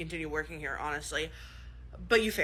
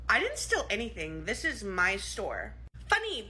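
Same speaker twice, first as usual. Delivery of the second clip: normal talking voice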